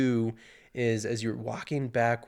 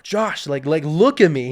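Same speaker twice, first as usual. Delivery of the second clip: raised, firm voice over the wind, higher and louder; calling out to get attention; 4 semitones higher, 10 dB louder than usual. Retold or imitated. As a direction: call his name to snap him out of it. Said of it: "he's like".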